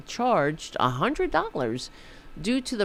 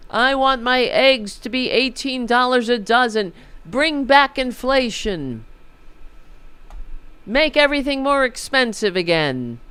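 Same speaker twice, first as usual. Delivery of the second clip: higher, louder sarcastic put-on voter voice; mock voter; 6 semitones higher, 5 dB louder than usual. Ridiculing voters who wanted this, sarcastic and loud. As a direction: parody a voter cheering on high prices.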